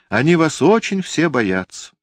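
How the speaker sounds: put-on high, loud boy's voice; a defiant little boy speaking up; child voice. Boy tells aunt Liza, child voice.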